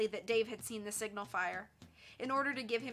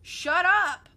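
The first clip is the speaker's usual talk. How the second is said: high, loud silencing command; shouted order; 9 semitones higher, 13 dB louder than usual. A narrator's reading. Boss silences his blabbing henchman, shouting an order. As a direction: shout them down. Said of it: "Diamond ordered".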